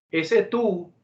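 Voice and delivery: ordinary talk, nothing marked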